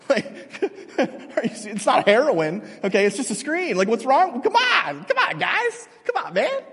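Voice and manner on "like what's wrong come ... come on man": high, pleading mock exasperation; exasperated mock pleading. Parent mocking kids who freak out over screens, high exasperated pleading. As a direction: throw your voice up in comic exasperation.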